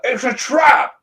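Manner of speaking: high, loud shouted warning; wedding objection shout. Pretend. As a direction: yell it across the church.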